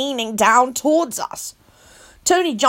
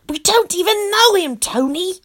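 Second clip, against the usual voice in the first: grumbling; 8 semitones higher than usual. Acted. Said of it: "I grumbled".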